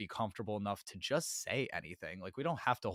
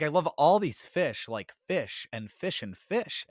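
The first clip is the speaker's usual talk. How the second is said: louder, higher burst of exaggerated enthusiasm; mock gushing; 6 semitones higher, 7 dB louder than usual. Podcaster mocking herself for blanking, in a hammed-up gush. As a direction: gush in self-mockery, then drag out the list.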